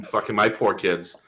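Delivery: ordinary delivery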